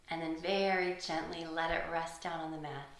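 ordinary talk

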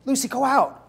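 high gushing encouragement; lightly hammed generosity; mock eager. Boyfriend telling Lucy to go, mock gushing.

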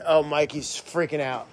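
ordinary talk, nothing marked

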